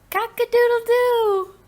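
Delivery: loud, very high crowing; rooster crow. Shown as a demonstration. Teacher demonstrating animal sounds, high rooster crow. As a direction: crow like a rooster, holding the last note. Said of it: "Animal sounds".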